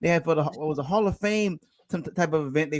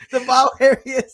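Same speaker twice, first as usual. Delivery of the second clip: normal talking voice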